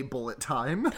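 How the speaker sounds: bullet time in a bad German accent. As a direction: say it like a hammy German director. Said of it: "his bad German accent and say".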